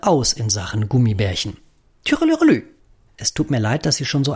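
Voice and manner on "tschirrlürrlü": high chirpy budgie trill; sing-song bird twitter; birdsong trill. Acted.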